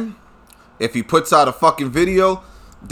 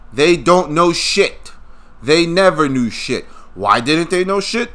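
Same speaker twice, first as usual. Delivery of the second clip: ordinary talk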